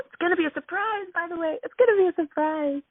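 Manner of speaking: high, sing-out retold excitement; excited promise. Mother telling her daughter about the party plan, excited.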